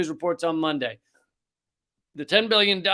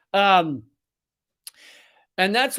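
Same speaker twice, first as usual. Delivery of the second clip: ordinary delivery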